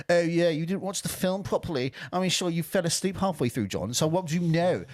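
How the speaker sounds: high-pitched mock of a snooty commenter; mocking hipster critic. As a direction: put on a condescending critic to mock them.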